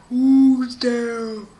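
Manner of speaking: loud, drawn-out challenge; booming doorkeeper. Acted. Somebody behind the door challenges the visitor, booming voice. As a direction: boom it through a giant door.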